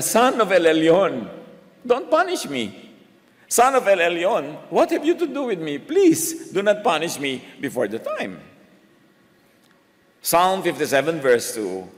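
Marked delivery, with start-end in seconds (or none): 0.0-8.5 high, begging demon voice climbing on please; pleading evil spirit. Retold or imitated. Evil spirit begging Jesus not to punish it, high and pleading. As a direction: act out a frightened spirit begging for mercy. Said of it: "Sabi namang evil spirit".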